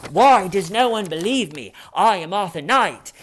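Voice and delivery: mock his indignant plea in a silly fake accent; Nicholas Alaverdian impression. Suspect insists to interviewers he is someone else, hammed-up accent. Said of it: "an impression of what he does".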